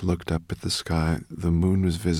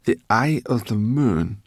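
slow, louder, higher questioning; Sorrel's raised-voice query; puzzled echo; 4 semitones higher, 7 dB louder, slower than usual. A narrator's reading. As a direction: repeat it slowly in wonder. Sorrel questions the dragon's words.

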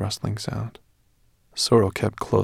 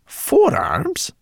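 surprised; 5 dB louder than usual. A narrator's reading. Sorrel surprised.

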